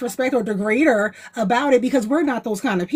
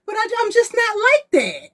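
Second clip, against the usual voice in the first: high self-quote; 10 semitones higher than usual. Retold or imitated. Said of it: "I'm like".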